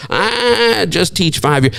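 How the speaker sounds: high, drawn-out mock shrug of a voice; dismissive teacher. Preacher mimicking a teacher shrugging off the class, dismissive. Voicing a teacher belittling own job.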